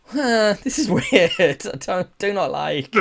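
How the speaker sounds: high, laughing, creeped-out reaction; laughing cringe. Retold or imitated. Reviewer imitating a viewer squirming at the date, laughing. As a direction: laugh through your discomfort at the weird scene. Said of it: "and you're like".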